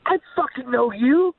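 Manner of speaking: high, drawn-out mock exclamation; excited greeting. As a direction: greet a long-known stranger with slow, gleeful amazement. Acts out excited greeting from David.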